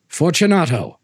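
calling out. Acted. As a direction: call into the niche. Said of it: "I called again".

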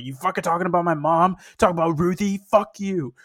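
mock furious snapping back; a heated retort, played up; imitating an offended wrestler yelling back off mic; imitating Eddie's angry outburst. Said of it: "you just hear Eddie being like".

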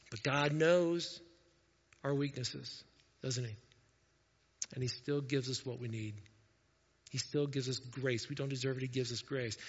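quiet sincere comfort; tender reassurance. Acted. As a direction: comfort them gently. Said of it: "tender reassurance of grace".

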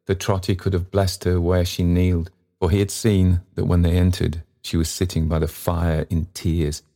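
usual speaking voice

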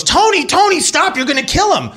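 high-pitched imitation of a panicked scream; screaming mother. Mother begging dad to stop the beating, imitated high scream. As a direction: shriek it like a frantic mom at the window. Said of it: "I hear my mother screaming".

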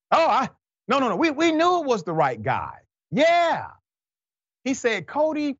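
very high-pitched mocking squeal of denial; high falsetto parody of excuse-making; mock backpedaling. Mimics police covering their mistake.

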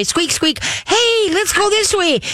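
very high, slow hey let's go this way; high coaxing call. Shown as a demonstration. Owner luring a dog a block away, very high coaxing call. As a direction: coax the dog with a sing-song lure. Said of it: "squeak that toy".

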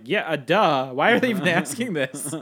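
loud, exasperated "duh" delivery; mock incredulity. Viewer scoffing at the alien interrogation scene, loud incredulity. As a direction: raise the voice as if it's painfully obvious. Mocking the film's obvious question.

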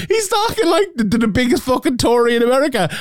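very high, half-laughing disbelief; high-pitched, amused exasperation; incredulous ridicule. Real, not acted. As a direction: scoff in a high voice at the absurdity. Ridiculing Paddy as a hypocrite.

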